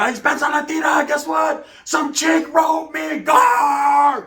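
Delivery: high, loud shout; yelling across the theater. Retold or imitated. Ex-con yelling to his homeboy across the theater, loud and thrilled. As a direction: yell the news across the theater. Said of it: "I'm yelling down the theater".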